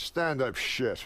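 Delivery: slowly drawn out, each word weighed; slow pronouncement. Retold or imitated. Rodney telling the young comic his lesson, slow. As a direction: deliver it like sage final advice. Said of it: "He goes".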